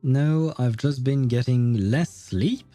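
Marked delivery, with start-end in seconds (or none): none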